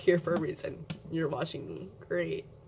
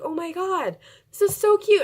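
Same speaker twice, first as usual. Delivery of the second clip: much higher and louder on "so cute", squealing; excited squeal; 10 semitones higher, 5 dB louder than usual. Retold or imitated. Opening her brush package, squealing with delight. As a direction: gush over the cute case like a thrilled kid. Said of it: "and I was like".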